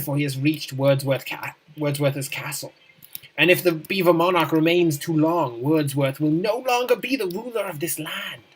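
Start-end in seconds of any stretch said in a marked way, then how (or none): none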